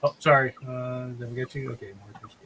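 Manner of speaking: normal talking voice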